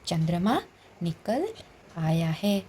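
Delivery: each word set apart with pauses, rising on "चंद्रमा" and "निकल". Shown as a demonstration. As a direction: separate "चंद्रमा", "निकल", "आया है" with clear pauses. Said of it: "शब्द इसमें कौन कौन सी चीज़ है". (हर शब्द को अलग अलग रुककर बोलना)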